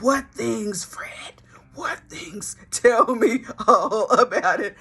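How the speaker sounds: hushed whisper turning into a laugh; nosy husband whispering, then laughing; eager whisper. Retold or imitated. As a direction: beg for the secret, then crack up.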